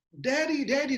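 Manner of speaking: put-on a girl calling for her father from the balcony; child calling daddy. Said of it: "Dia panggil nama bapaknya". (memanggil ayah dengan suara anak yang tinggi)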